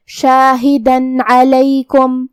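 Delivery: شاہدن علیکم with the tanween said as a clear nun before the ain, no ghunna, slowly; clear tanween demo. Shown as a demonstration. Teacher demonstrates izhar for students, slow and clear. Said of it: "بغیر غنہ سے یہ حکم کو ادا کریں".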